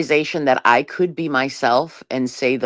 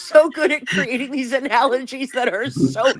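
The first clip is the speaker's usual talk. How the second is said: laughing; 11 semitones higher than usual. Real, not acted. Praising friend while laughing.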